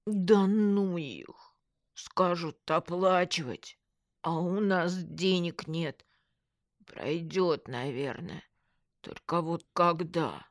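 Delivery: slow, slurred grumble; drawn-out, resigned complaint, lip numb; glum numb-lipped friend. A narrator's reading. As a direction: drag the words through a crooked mouth, dismissive then forlorn.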